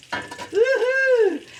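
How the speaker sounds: a high woohoo; joyful cheer. Real, not acted. Showing the noodles to viewers, a high happy whoop. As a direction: whoop with delight. Excited to show the cooking.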